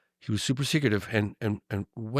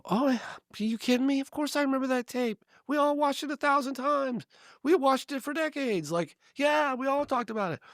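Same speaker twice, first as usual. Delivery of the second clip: very high falsetto woman's voice held throughout; high imitated woman, all enthusiasm; Connie's voice. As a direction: play the eager local lady, high and bright. Voicing Connie in the story.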